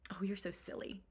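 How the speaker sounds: soft, hushed whisper; the nanny whispers it, brushing off the worry. Retold or imitated.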